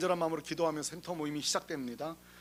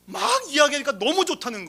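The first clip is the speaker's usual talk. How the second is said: higher and louder, marvelling; excited disbelief; 7 semitones higher, 10 dB louder than usual. Real, not acted. Pastor retelling the man's praise, amazed and raised. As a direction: tell it with amazed delight.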